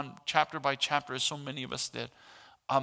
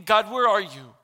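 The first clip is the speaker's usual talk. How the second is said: louder, higher call of despair; anguished cry; 5 semitones higher, 9 dB louder than usual. Retold or imitated. Pastor voicing mourners crying out, loud anguished plea. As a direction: cry out to a God who seems absent.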